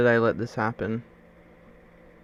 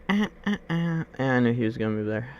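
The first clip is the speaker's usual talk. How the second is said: ordinary talk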